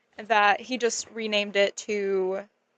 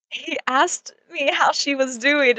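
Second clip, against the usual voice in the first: ordinary delivery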